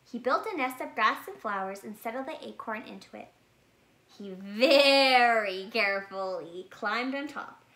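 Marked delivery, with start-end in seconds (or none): 4.1-7.6 slow, deliberate, drawn out; careful slow narration. A narrator's reading. Narrator slows down as the bear climbs on the nest. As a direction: tiptoe through the words as if climbing carefully.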